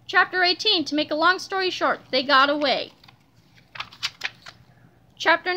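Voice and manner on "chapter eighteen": ordinary delivery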